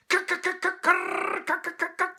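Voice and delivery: very high, loud scolding chatter; angry squirrel chatter. A narrator's reading. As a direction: chatter furiously like a cross squirrel. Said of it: "scold and shout".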